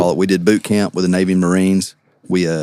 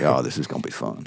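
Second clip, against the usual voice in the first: low, hushed plotting voice; conspiratorial aside; 8 semitones lower, 9 dB quieter than usual. Retold or imitated. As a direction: drop low and soft, like scheming a prank. Retells plotting the prank.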